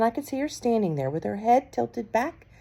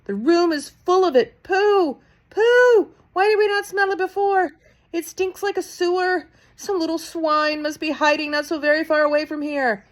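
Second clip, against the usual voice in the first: much higher, loud disgusted witch cries; disgusted witches; 10 semitones higher, 5 dB louder than usual. A narrator's reading. Witches cry out in disgust to each other, high and loud. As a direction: sniff and shout about the stink.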